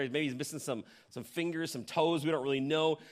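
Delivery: ordinary talk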